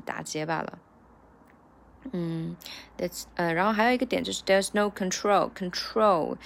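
usual speaking voice

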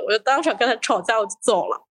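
normal talking voice

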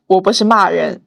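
usual speaking voice

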